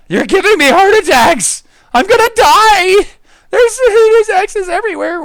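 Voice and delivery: playful startled yell; startled mock panic. Real, not acted. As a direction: shriek at being startled. Startled shouting at friend.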